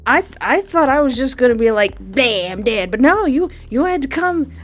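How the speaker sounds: high, loud exasperated complaint; indignant rant. Real, not acted. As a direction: complain to the game, outraged. Ranting at the game.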